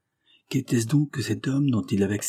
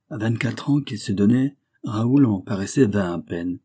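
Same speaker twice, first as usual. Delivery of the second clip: ordinary delivery, nothing marked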